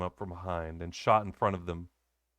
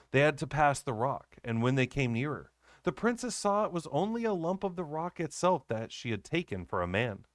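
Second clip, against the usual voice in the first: ordinary delivery, nothing marked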